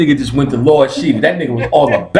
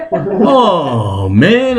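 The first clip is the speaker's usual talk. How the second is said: dramatic; 7 semitones higher, 4 dB louder than usual. Retold or imitated. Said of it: "'cause he did it so dramatic".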